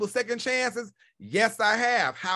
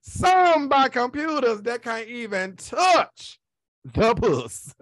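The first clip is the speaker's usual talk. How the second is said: slowed, laughing through it; laughing repeat; 4 dB louder, much slower than usual. Real, not acted. Laughing at her own joke to viewers, slow. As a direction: drag it out while cracking up. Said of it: "repeated slower, laughing".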